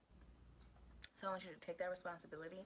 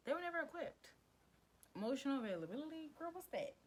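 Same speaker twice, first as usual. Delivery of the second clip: mock dismissive parent; 8 semitones higher than usual. Voicing parents dismissing needs.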